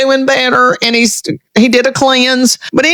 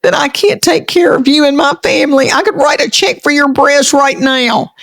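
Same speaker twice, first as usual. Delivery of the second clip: a higher, half-hearted imitation of her husband's anger; mock angry husband; 6 semitones higher than usual. Wife imitating her offended husband, mildly mock angry. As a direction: retell his indignant outburst lightly, pitched up. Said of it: "he got so mad and said".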